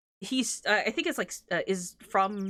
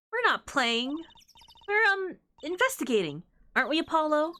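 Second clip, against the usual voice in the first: put-on higher, bright-eyed young girl; higher cheerful girl voice; perky girl voice; 7 semitones higher than usual. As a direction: sweetly insist you're investigating.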